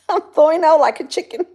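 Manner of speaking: giggling through the words; laughing. Real, not acted. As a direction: laugh while you say it. Laughing while joking.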